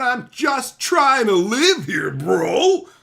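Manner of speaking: high, loud and slow exasperated cry; exasperated shout. Real, not acted. Fed up with chat, a loud slow exasperated cry. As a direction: plead loudly to be left alone.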